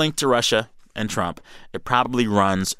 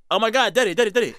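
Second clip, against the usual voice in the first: high, mock-frantic calls to Dad; high-pitched retold exclamation; imitating excited son; 12 semitones higher, faster than usual. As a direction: imitate a son excitedly calling his daddy. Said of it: "Tad is just like".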